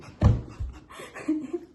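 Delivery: breaking into helpless laughter; real laughing fit. Real, not acted. Starting the second anecdote, bursts into laughter. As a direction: burst out laughing mid-sentence.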